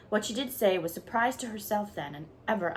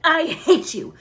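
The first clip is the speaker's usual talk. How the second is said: much higher, far louder outburst; girl screaming at the rude neighbour, furious; choked furious cry; 9 semitones higher, 13 dB louder, slower than usual. A narrator's reading. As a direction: hurl it at her, choking with rage. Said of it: "she cried in a choked voice".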